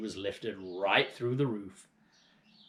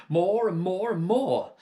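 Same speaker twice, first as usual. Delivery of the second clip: excited; 8 semitones higher, 8 dB louder, slower than usual. Acted. Father greedily dreams aloud.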